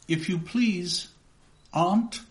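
put-on a small boy's hesitant plea; timid boy. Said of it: "I began".

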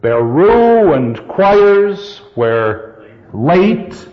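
"Bare ruined choirs where late" very slow, weight on "ruined", "choirs", "late"; marking the accents. Shown as a demonstration. Teacher finding the next accent, beats on "ruined", "choirs", "late" drawn out. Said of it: "What's the next one?".

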